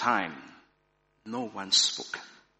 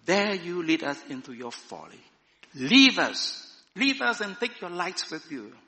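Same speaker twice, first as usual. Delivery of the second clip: raised, indignant rebuke; indignant cry; 6 semitones higher, 6 dB louder than usual. A narrator's reading. Cave dwellers banishing the woman and stranger, indignant cry. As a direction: cry out in protest, voice lifted. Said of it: "cried the cave dwellers".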